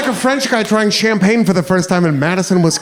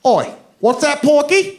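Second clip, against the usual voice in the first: put-on a light drunk British accent, higher; 8 semitones higher than usual. Drunk British guy taunting big American, light British accent. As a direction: sneer it in a Cockney accent. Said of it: "And he said".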